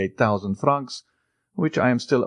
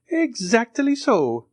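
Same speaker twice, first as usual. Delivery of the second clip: high, slow, pleased; delighted; 3 dB louder, slower than usual. A narrator's reading. The major delights in the count's words, high and slow. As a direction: savour it with delight. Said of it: "said the delighted major".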